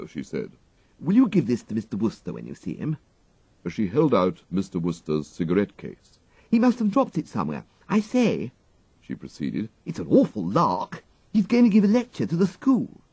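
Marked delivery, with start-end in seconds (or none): 1.0-3.0 put-on higher, bright little girl; chirpy child asking a favour; lively schoolgirl. A narrator's reading. The Mannering child hands the valet a cigarette case, chirpy girl voice. 6.5-13.0 put-on higher, quick mischievous girl; gleeful schoolgirl. A narrator's reading. The girl gleefully tells the valet about the lecture, mischievous child.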